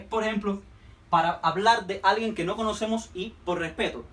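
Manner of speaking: ordinary talk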